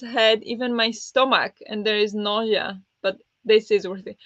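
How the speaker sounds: ordinary delivery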